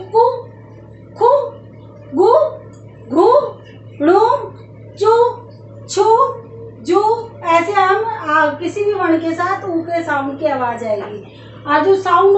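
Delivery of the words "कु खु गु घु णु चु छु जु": each syllable said alone with a pause; sounding out syllables. Shown as a demonstration. Teacher sounding out syllables for pupils. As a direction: sound out कु, खु, गु one by one for the class. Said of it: "इसका उच्चारण करेंगे तो क्या बोलेंगे".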